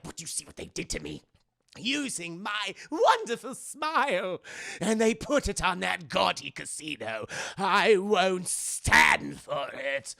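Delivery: theatrical, outraged villain, slow and stretched out; hammed-up wounded indignation; Joker. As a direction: complain grandly with loud swells. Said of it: "it just sets Joker off".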